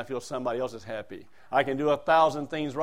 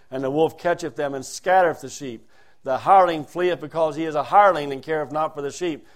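louder, fast KJV reading building to a ringing peak; scripture reading; 5 dB louder than usual. Acted. Preacher reading John ten to the congregation, rising proclamation. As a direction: proclaim the passage to the flock.

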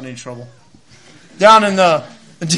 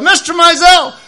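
much higher, slow, encouraging; girl's voice; 10 semitones higher, 7 dB louder, slower than usual. Retold or imitated. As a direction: cheer him on like a young student. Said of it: "Abby looks over and goes".

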